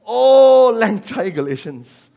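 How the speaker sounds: loud, high, stretched opening 'Oh'; mock exclamation. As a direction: burst out, then ease off slowly. Mock polite versions of rebuke.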